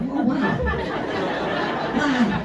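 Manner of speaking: high, wonderstruck wow; mock awe. Cyclist suddenly able to think clearly, acted awe. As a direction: act out sudden dawning amazement. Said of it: "you can finally think clearly".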